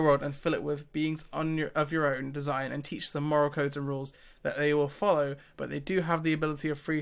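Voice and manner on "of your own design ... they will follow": normal talking voice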